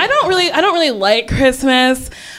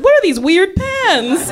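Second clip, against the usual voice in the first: put-on high, slow puzzled kid; curious kid; 7 semitones higher, slower than usual. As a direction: wonder aloud at the pens.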